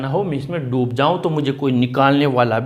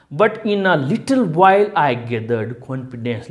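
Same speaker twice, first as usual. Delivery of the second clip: slow reading aloud; 3 semitones lower, much slower than usual. Shown as a demonstration. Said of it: "teacher reads text line slowly".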